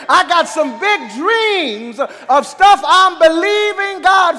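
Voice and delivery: fervent pulpit proclamation; full-force preaching. Real, not acted. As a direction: preach it out to the congregation, stretching key words.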